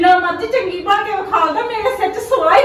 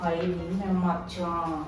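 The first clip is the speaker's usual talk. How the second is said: low, slow, hushed gasp of dismay; dismayed aside; 10 semitones lower, 10 dB quieter, much slower than usual. Acted. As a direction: sink into a slow worried groan. Mother alone, panicked aside.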